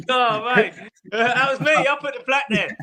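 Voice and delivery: high, loud burst through laughter; laughing. Real, not acted. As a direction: crack up while owning up to the joke. Cracking up at plaque joke.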